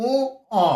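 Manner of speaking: উ, অ split into long "uu" and open "o"; letter-name demonstration. Shown as a demonstration. Teacher recapping the pronunciation for students, slowly separated. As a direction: hold উ, pause, then say অ. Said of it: "তাহলে উচ্চারণ কি বললাম".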